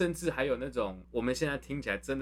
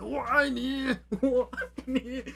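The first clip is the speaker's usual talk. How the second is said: high, slow comic anguished cry; gritted teeth love cry; 13 semitones higher, 6 dB louder, slower than usual. Retold or imitated. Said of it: "哭着咬牙切齿".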